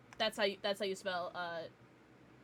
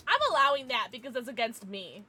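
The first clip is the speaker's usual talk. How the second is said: ordinary talk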